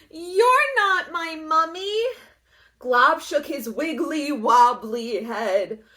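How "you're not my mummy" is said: little mummy voice. A narrator's reading.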